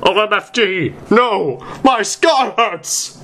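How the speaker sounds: high, hammed-up screaming cry of pain, slowing at the end. A narrator's reading. Vampire screaming in pain as his eyes roll up, hammed-up shriek. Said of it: "He started to scream".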